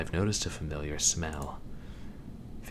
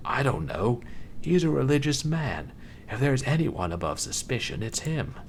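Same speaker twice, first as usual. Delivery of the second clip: incredulous; 8 semitones higher, 3 dB louder than usual. Acted. Incredulous outburst about priest.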